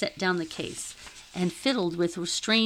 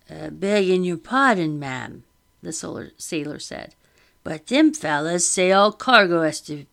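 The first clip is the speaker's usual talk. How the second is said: loud, rough apologetic sailor; humble sailor voice; 6 dB louder than usual. Acted. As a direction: plead like a common deckhand.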